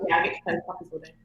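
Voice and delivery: ordinary delivery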